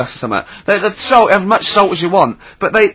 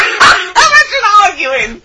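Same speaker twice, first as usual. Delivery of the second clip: laughing; 13 semitones higher, much slower than usual. Real, not acted. Laughing hard at the idea.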